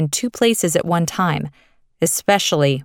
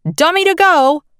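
hollered call; 11 semitones higher, 5 dB louder than usual. A narrator's reading. Head copy editor calls copy aides to run, hollering. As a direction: call it out like an editor summoning a runner. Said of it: "He would holler".

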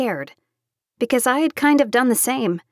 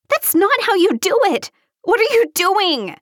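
high, frantic scream; 10 semitones higher than usual. A narrator's reading. Taylor at the guy doing a bad Heimlich, screaming. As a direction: shriek at someone botching a rescue. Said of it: "Taylor screamed and started punching the guy".

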